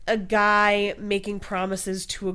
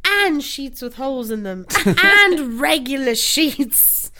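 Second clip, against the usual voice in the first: man doing a woman's voice; 5 semitones higher, 5 dB louder, slower than usual. Retold or imitated. Continues the sketch girl bit.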